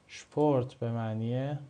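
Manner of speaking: شپورت with sh before the p, stressed; German Sp as shp. Shown as a demonstration. Teacher repeating the example word for the class.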